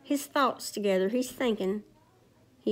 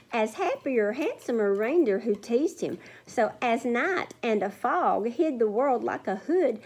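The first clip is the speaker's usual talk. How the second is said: lilting read-aloud rhyme; sing-song story time voice; storybook reading; 4 semitones higher than usual. Acted. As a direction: read it like a picture book to kids. Reads rhyming story to children.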